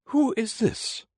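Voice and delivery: very high, slow, stern demand; severe Queen. A narrator's reading. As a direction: demand it slowly as a haughty, high-voiced Queen. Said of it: "the Queen said severely".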